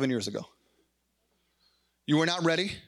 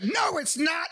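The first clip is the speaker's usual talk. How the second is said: put-on a child's protest, pitched higher and shouted; whiny kid; 8 semitones higher, slower than usual. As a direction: protest like a stubborn little kid. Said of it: "and the kid's, like".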